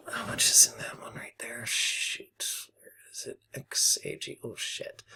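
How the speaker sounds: soft whisper while searching the screen; whispered to himself. Real, not acted. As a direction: mutter it under your breath. Checks account, muttering to himself.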